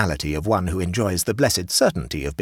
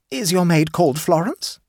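a hint of a fussy higher hostess; lightly voiced hostess; 12 semitones higher, 5 dB louder than usual. A narrator's reading. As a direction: raise the pitch for the chatty hostess.

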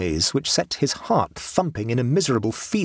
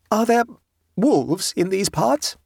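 high, anxious question; anxious traveller's query; hurried question; 9 semitones higher, slower than usual. A narrator's reading. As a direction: ask it with raised, worried pitch. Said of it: "asked Abbleway hurriedly".

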